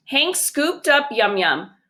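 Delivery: plain reading voice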